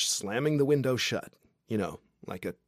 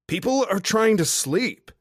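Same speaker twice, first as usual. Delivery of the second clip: sharp, loud, pitched-up hiss of a yell down to the street; annoyed yell; 11 semitones higher, 9 dB louder than usual. Acted. Woman yelling from her hotel window at a drunk guy, annoyed. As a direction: shut him up without waking the hotel.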